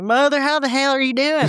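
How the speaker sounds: hammed-up Southern twang, high-pitched; Southern accent impression. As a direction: mimic a son calling in a heavy Southern accent. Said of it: "how does it sound?".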